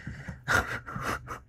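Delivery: soft voiceless laugh; breathy laugh. Real, not acted. Poet laughing softly at her own line. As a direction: let a quiet laugh slip out.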